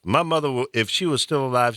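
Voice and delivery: normal talking voice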